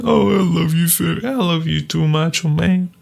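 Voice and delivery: high, sappy lovers' exchange; mock love declaration. Macho man and fairy confessing love, high and sappy. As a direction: gush it up high, hammed-up romance. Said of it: "fairy's like".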